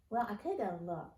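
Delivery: ordinary delivery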